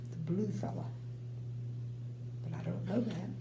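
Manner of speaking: ordinary delivery, nothing marked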